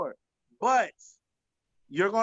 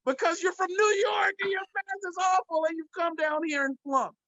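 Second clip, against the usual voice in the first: teasing shout; 7 semitones higher than usual. Real, not acted. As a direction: fire it off like a roast. Mock-scolding New Yorkers about math.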